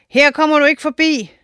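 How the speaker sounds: high, defiant storybook voice; voice of the swamp. A narrator's reading. The swamp blocking the giant, high defiant voice. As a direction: challenge the giant, high and proud. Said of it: "sagde sumpen".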